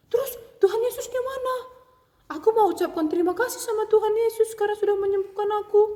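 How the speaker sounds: put-on high, fast little girl; eager child. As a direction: ask eagerly and quickly.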